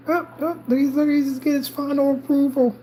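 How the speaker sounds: sympathetic aww. Real, not acted. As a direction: coo at the cat in a high voice. Cooing to the cat.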